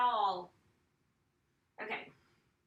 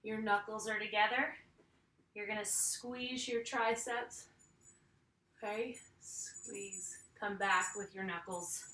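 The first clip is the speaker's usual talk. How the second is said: ordinary talk, nothing marked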